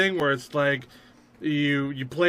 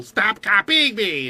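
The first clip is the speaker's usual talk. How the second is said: little minion voice; 6 semitones higher, 5 dB louder, slower than usual. Acted.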